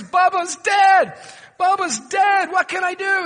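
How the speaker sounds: high, loud panicked shouting; frantic panic. Retold or imitated. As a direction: yell it in a hunter's panic. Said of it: "he frantically tells the operator".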